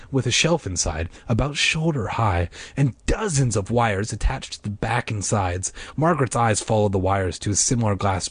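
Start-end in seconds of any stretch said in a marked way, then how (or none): none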